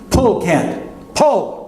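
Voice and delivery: high shout rising on the second pull; desperate yell. Retold or imitated. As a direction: yell the command in panic. Desperate rescue order.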